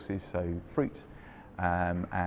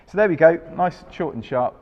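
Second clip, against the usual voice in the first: upbeat sign-off; 9 semitones higher, 12 dB louder than usual. Real, not acted. Cheerful wrap-up of tasting.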